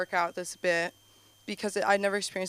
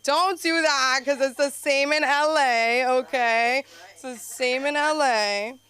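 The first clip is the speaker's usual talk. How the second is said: raised, high, insistent plea; a raised exasperated voice arguing with mom; exasperated insisting; 3 semitones higher, 5 dB louder, slower than usual. Retold or imitated. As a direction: loudly talk mom out of it.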